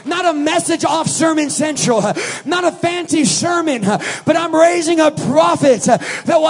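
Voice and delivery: loud, slow shouted preaching; full-force preaching. Acted. Preacher shouting to the congregation, full force. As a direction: preach it out to the back row.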